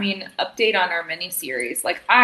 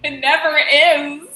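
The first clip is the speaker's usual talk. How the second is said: stretched out, louder and higher; slow loud emphasis; 7 semitones higher, 9 dB louder, slower than usual. Acted. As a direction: lean hard on it. Dramatic agreement.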